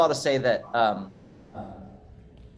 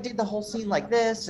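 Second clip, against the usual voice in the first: higher-pitched, deliberately poor cartoon impression; SpongeBob; 9 semitones higher than usual. Shown as a demonstration. Actor shows how they did the audition scene, bad cartoon impression. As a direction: lightly put on a high character voice. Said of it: "the worst SpongeBob impression".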